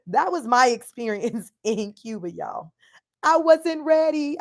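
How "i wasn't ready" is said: high, slow, put-on comic voice; Kevin Hart impression. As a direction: do a Kevin Hart bit, drawn out. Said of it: "in my Kevin Hart voice".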